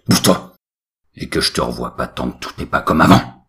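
loud, firm almighty telling-off; booming fed-up father; angry father scolding. A narrator's reading. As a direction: lay down the law, loudly. God scolds his lazy son.

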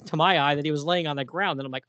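ordinary delivery

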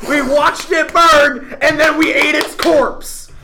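loud, emphatic mock-epic boast; punched-out victory speech; boastful declaration. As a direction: proclaim the deed, building to a shout. Player boasts of killing the bear.

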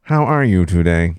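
slow talking-toy voice; measured, slow toy-robot delivery; Speak and Spell. Acted. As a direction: ask it like an electronic learning toy. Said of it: "She got a speak and spell".

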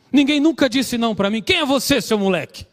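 put-on high-pitched indignant outburst of a girl; imitating a furious girlfriend's indignant rant. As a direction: retell her furious outburst, pitch jumping up. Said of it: "furiosos, né, irritados, indignados".

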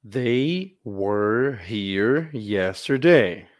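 slow, clear English; slow teacher demo. Shown as a demonstration. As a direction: model it slowly for the class. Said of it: "lesse comigo". (leitura lenta)

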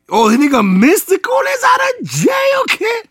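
high-pitched excited holler; excited shout. Real, not acted. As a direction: shout out big news, pitch soaring. Breaking news called out excitedly.

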